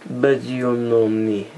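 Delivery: slow, badly faked Russian accent; terrible Russian accent. Vlogger joking to viewers, terrible mock Russian accent. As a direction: put on a clumsy Russian accent, drawn out. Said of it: "like, a terrible Russian accent".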